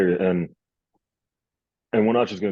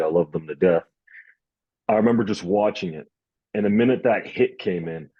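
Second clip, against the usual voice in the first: usual speaking voice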